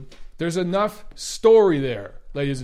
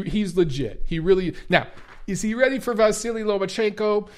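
usual speaking voice

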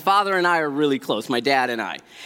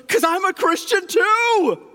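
high excited instructor; 12 semitones higher than usual. Retold or imitated. Instructor quoted by the speaker.

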